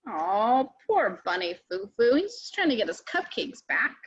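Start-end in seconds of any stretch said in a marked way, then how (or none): none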